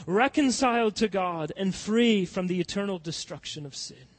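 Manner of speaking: loud, rising pulpit preaching; fiery preaching. Acted. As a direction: preach it hard to the congregation. Preaching at full force.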